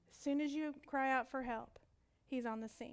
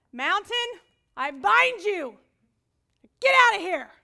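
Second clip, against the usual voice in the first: much higher and louder, slow commanding yell; mock shouting command; 7 semitones higher, 16 dB louder, slower than usual. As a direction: yell it at the mountain, full authority. Sister shouting commands at mountain.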